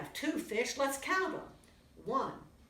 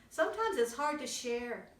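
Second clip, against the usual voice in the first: usual speaking voice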